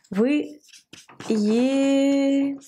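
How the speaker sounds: едете in long held syllables with gaps; sounding out slowly. Shown as a demonstration. Teacher writing out the vous form, slow sounding-out. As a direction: stretch each syllable of едете.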